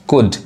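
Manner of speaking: कुड with a quick short "oo"; short sound. Shown as a demonstration. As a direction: say कुड briefly, silent L. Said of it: "fast पढ़ा रहा है क्योंकि short sound है". (कुड, छोटे "ऊ" की आवाज़ में)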